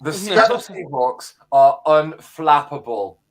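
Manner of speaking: slow, loud, pompous pundit read; trying to sound smart. Acted. Reading his written verdict to the podcast, mock-clever. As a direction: read it like a very clever analyst, slowly. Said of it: "I tried to sound really, really smart".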